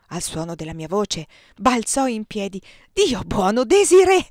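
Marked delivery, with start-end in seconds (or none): 2.9-4.2 a sudden, much louder and higher cry of her name; leaping up with a joyful shout of Desirée; joyful surprise. Acted. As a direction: burst out in delighted shock. He leaps up on hearing her.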